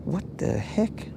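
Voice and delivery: slow, breathy, disbelieving; baffled whisper. Real, not acted. Streamer baffled by a chat comment, whispering slowly. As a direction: murmur it like you can't believe it.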